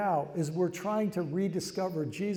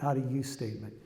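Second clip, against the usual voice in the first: normal talking voice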